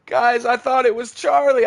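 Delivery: high, loud panicked yelling; panicked shouting. Real, not acted. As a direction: shout out in alarm. Fleeing after mistake.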